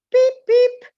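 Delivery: high, loud peeping; chick peeps. Shown as a demonstration. Child in the guessing game peeping like a chick. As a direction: peep like a baby chick. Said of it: "try to guess what kind of bird".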